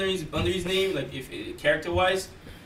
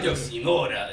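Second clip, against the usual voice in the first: low, softer mock Italian accent; thick Italian accent; 8 semitones lower, 5 dB quieter than usual. Fan imitating a game character greeting a woman, mock Italian accent. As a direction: put on a suave Italian greeting. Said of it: "Make sure my accent's thick as hell".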